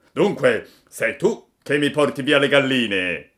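loud accusing farmer voice; angry farmer. A narrator's reading. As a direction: confront the chicken thief.

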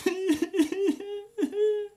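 high-pitched wailing sobs; shrill puppet boo-hoo; despairing sob. A narrator's reading. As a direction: burst into squeaky, hammed-up crying. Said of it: "mentre si disperava a questo modo".

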